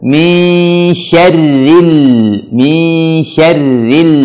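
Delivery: "syarril" held long with L flowing, no bounce; flowing, unbounced lam. Shown as a demonstration. Teacher showing learners the lam, "syarril" drawn out without bouncing. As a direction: let the L of "syarril" flow out slowly. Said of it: "tapi alirkan".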